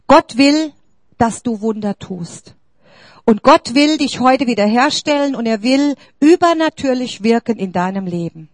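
ordinary talk, nothing marked

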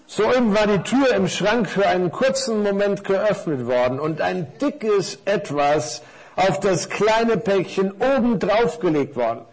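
normal talking voice

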